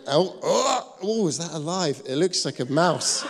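high, laughing alarm; hammed-up high-pitched disgust, half laughing; mock horror. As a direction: squeal like something alive is in the drain. Acted horror at the drain.

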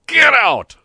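very high, very loud shout; bawled order. A narrator's reading. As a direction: bawl at him to leave. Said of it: "bawled Don Camillo".

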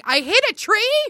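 retelling her own concussed confusion in a high laughing voice; dazed disbelief. Retold or imitated. To her friend, mimicking her dazed self after the crash, high and laughing.

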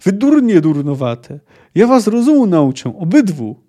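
loud, high angry telling-off; scolding yell. A narrator's reading. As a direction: holler at two foolish brawlers. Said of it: "wrzasnęła z trzaskiem". (głośny, karcący wrzask)

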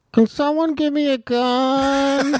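put-on high, drawn-out whiny kid voice; Jackson. Imitating his son's whiny friend begging for a gun, high whine. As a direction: stretch it into a long, high wail. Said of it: "You know, that's Jackson".

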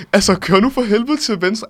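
loud, high-pitched urgent order; exasperated backseat-driver outburst, acted out; retold exasperation. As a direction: re-enact losing patience with the driver.

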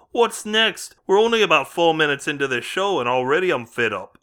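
hammed-up British accent all the way through; shocking British accent. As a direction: play the host in a put-on British accent. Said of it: "forgives me for this shocking accent".

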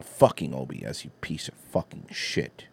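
ordinary delivery

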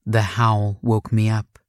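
ordinary delivery, nothing marked

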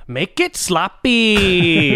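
slow, drawn-out celebrity impression; Rob Schneider voice. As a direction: slowly say make it sloppy. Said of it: "what he says".

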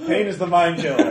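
high and loud, laughing through it; laughing mock solemn quote. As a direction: proclaim it grandly while laughing. Player quoting Dune dramatically.

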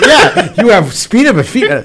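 high, laughing mock confirmation. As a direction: giggle through the joke. Joking reply, laughing.

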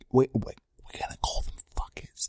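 hushed naughty whisper. Acted. Whispering the insult to the listeners. Conspiratorial aside about others.

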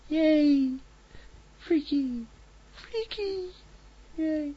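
very high, tiny squeaky cheer; squeaky freaky voice. Pretend. Radio host cheering in a squeaky freaky voice. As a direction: squeal it like a cartoon critter. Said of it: "with a freaky voice".

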